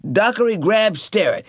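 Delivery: ordinary delivery, nothing marked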